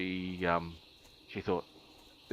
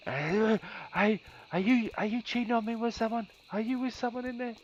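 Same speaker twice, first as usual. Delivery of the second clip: put-on suspicious girlfriend. Girlfriend at the bedroom window demanding if he's cheating, high frantic mock voice. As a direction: mimic her shrill-free panic, pitched way up.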